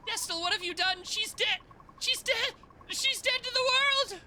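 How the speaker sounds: high, loud shriek of alarm; panicked fairy girl. Acted. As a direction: cry out as if she's gone.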